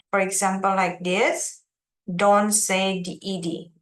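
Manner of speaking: usual speaking voice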